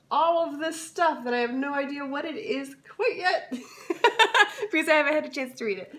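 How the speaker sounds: giggling through the words; laughing. Real, not acted. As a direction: laugh while you admit it. Laughing at unread book contents.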